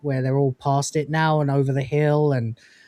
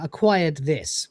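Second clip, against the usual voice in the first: usual speaking voice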